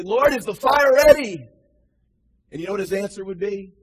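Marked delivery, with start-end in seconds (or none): 0.0-1.5 loud, higher pleading call; crying out to God. Acted. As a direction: cry it up to heaven. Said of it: "we need to ask and say".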